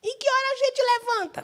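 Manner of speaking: put-on high, small child asking his mother; a little kid piping up in church; child's voice. Child asks mother when to stand, in a high kid's voice. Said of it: "E minha mãe dizia".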